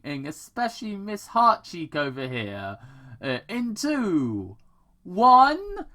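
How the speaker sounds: menacing countdown in a high, loud put-on voice; villain voice. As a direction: taunt them as the mad doctor counting down. Robotnik's lines, no naming.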